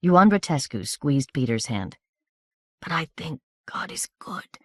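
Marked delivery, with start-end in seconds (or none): 2.8-4.6 barely voiced whisper of a dying soldier; murmured. A narrator's reading. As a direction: breathe it out as a faint whisper. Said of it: "murmured the corporal".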